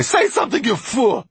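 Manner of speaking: high, loud, scornful screech; Magpie's shout. A narrator's reading. Mortola berating silent Basta, scornful shout. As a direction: shriek it as an insult. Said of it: "shouted the magpie".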